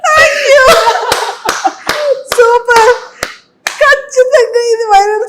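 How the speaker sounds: high, loud excited squealing; thrilled. Real, not acted. Host reacts to the romantic dialogue, real thrilled shouting. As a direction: gush loudly in a high, delighted voice.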